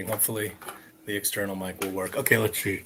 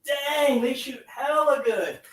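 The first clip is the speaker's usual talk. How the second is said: much higher, slow, wowed; amazed delight; 11 semitones higher, slower than usual. Real, not acted. Vlogger watching darts fly, high amazed delight. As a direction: gush over how well it shoots. Toy shoots powerfully.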